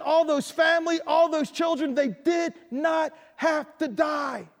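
Moses hot with anger. Pretend. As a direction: plead and scold a stubborn king. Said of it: "he was hot with anger".

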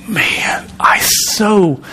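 sudden breathy whisper. Real, not acted. Preacher whispering his longing to the congregation. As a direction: drop to a hushed, urgent whisper.

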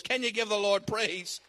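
usual speaking voice

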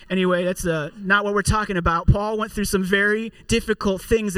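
usual speaking voice

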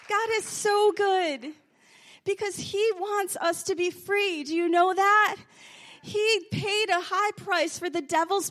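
loud, high fervent sermon; full-force preaching. Real, not acted. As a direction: proclaim it to the church.

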